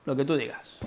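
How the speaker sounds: soft, dismissive throwaway; dismissive "lo que tú digas", thrown away soft; dismissive brush-off. Shown as a demonstration. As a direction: brush the speaker off without listening. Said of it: "No te hago ni caso".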